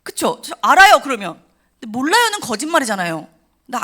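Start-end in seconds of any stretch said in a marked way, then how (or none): 0.4-1.0 mock loud answer. 1.9-2.4 mock loud answer.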